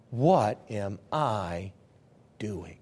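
slow, low murmur of dawning shame; hushed realization. Acted. Prodigal to himself, a slow hushed realization. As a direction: mutter it as the truth sinks in. Jonah waking up in hog pen.

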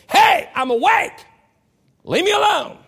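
high, loud, slow yell; retold shout. Exhausted ranger shouting at the soldier kicking him, high. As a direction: snap back at him from the ground. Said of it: "And I looked at him and I said".

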